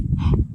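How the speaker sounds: sudden loud gasp of shock; sharp gasp. Real, not acted. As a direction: gasp like you just heard news. Shocked reaction.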